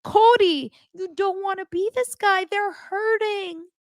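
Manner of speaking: very high mock weepy voice; mocking Robin. Vlogger imitating Robin's dramatic plea to Cody, very high. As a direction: simper it like fake tears. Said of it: "And then Robin says".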